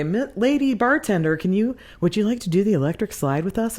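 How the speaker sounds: high, loud, put-on calling voice of the line-dance host; imitating the DJ lady. The DJ wife calling over to the lone bartender, high and loud. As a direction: sing out the invitation like a chirpy party host. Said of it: "She'd look over at me and say".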